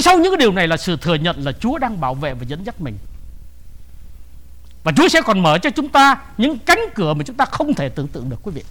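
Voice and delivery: ordinary talk, nothing marked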